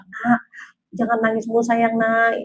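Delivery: retold soft hushing of a child; weary, softened soothing; soft parental plea. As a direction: plead quietly with a crying child. Mother soothing crying child, retold.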